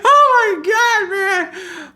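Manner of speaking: very high, squealed through laughter; slow, high and loud, weak from laughing; real helpless laughter. Real, not acted. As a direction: gasp it out while cracking up. Cracking up at own joke.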